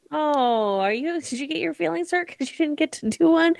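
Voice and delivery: soft, teasing coo; mock sympathy. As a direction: coo softly as if soothing hurt feelings. Teasing a co-host with pity.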